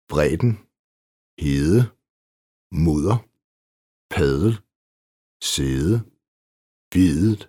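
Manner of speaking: bredden, hede, mudder, padel, sæde, videt with th-like D; soft D. Shown as a demonstration. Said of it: "som regel i dobbeltkonsonant". (blødt D i bredden, hede, mudder, padel, sæde og videt)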